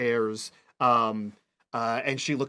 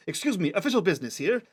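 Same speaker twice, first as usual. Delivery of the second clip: quick, higher-pitched officious announcement; brisk, pitched-up official voice; 6 semitones higher, faster than usual. Acted. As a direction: brush past as a child on important business. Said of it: "she sounds very official".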